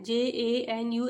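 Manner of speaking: slow, separate letters; each letter on its own, slowly; wrong way, slow. Shown as a demonstration. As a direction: plod through each letter. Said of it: "ऐसे नहीं बोलना".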